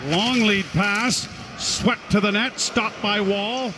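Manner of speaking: ordinary talk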